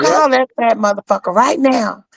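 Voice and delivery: high, insistent mock command; bossy demand. Mama D ordering Tori to call, insistent. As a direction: order her to call him now.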